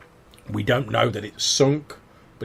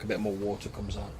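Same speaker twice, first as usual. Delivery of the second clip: usual speaking voice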